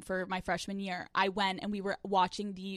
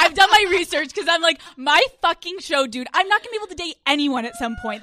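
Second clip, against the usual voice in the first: loud excited venting; 7 semitones higher, 10 dB louder than usual. Real, not acted. Venting about dating while hosting show.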